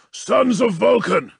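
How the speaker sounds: high, slow rallying cry; rally the brothers to arms; battle cry. A narrator's reading. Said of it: "declared Obek".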